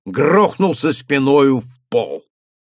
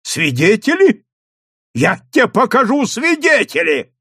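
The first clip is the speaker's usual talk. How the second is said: громко и выше, с угрозой; пригрозить сыщику; гневная угроза; 9 semitones higher, 3 dB louder than usual. A narrator's reading. Управляющий грозит в гневе. (совхоз в гневе грозит)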